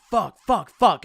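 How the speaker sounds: mock freak-out, punching each curse. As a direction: act out a friend's mounting panic. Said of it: "Sam starts freaking out".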